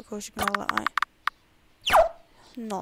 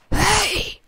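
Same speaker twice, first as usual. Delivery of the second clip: higher, louder startled yell; shout; 8 semitones higher, 7 dB louder than usual. Acted. Guard shouting at the intruder, loud shout. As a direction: bark it to stop someone.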